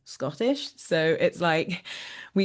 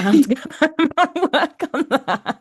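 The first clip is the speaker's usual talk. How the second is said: higher and louder, laughing through it; 11 semitones higher, 8 dB louder than usual. Real, not acted. Actress joking to interviewer about accent homework, laughing. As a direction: giggle the words out, half embarrassed.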